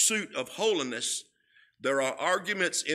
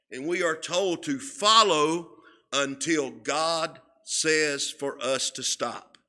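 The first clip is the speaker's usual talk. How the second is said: normal talking voice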